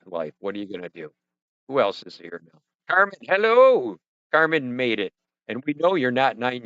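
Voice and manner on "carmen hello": loud, high drawn-out hello; big stretched-out greeting, pitched up; calling out hello. Real, not acted. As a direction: hail a late arrival across the room. Calling out greeting to arriving viewer.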